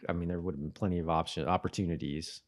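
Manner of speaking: usual speaking voice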